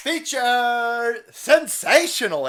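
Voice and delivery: slow, high, loud drawn-out announcer voice; hammed-up hype; mock announcer. Mocking YouTube feature announcement.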